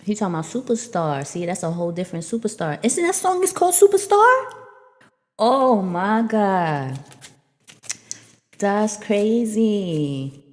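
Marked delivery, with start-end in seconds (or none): 2.8-10.4 excited surprise. Real, not acted. Excited realisation about the song.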